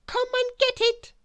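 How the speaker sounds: very high, loud sing-song call; bossy calling out. A narrator's reading. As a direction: call him to the table from on high. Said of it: "she called out".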